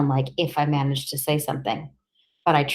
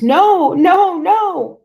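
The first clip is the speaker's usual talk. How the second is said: high, loud screaming no; boy on the floor screaming at the policeman; terrified scream; 14 semitones higher, 7 dB louder, much slower than usual. Acted. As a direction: scream in panic as if about to be kicked. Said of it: "And I screamed".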